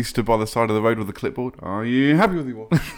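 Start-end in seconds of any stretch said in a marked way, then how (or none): 1.6-2.9 higher, louder doppler swoop; like a car whizzing past; passing by doppler. Pretend. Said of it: "Nice, nice Doppler effect".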